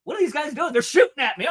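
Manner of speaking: high, startled mock cry of a man under fire; mock panicked victim. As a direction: play the shocked target, pitch jumping way up. Acting out the victim at the parade.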